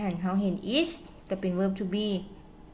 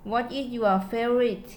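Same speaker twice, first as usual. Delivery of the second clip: slow model sentence; 3 semitones higher, much slower than usual. Shown as a demonstration. Teacher to students giving an example, very slow. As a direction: read it out slowly for learners to copy. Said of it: "ตัวอย่าง".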